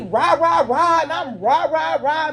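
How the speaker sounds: high-pitched "rah rah" argument noise; punchy mock barking back and forth; mimed bickering. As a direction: imitate two people squabbling in nonsense syllables. Imitating two people arguing back and forth.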